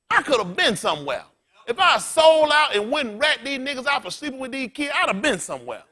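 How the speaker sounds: high-pitched, worked-up preaching; impassioned preaching. Real, not acted. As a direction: testify with rising heat. Preacher worked up over testimony.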